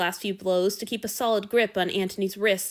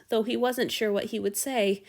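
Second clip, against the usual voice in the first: ordinary delivery, nothing marked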